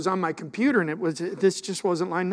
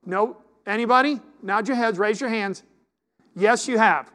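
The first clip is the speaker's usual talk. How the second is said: calling out; 5 semitones higher, 8 dB louder than usual. Real, not acted. Calls out to the congregation.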